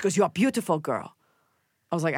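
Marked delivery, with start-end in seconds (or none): 0.2-1.1 lightly imitated Korean accent; Doctor Na's Korean accent. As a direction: compliment with a slight Korean accent. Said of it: "I'm imitating her".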